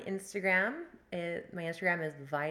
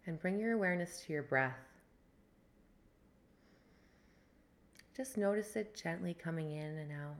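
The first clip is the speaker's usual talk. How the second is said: guided meditation. Acted. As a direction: ease them into the breath. Leads a breathwork meditation.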